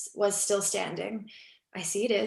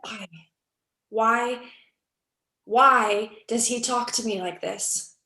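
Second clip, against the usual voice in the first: distressed outburst; 6 dB louder than usual. Acted.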